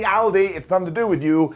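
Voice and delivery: ordinary delivery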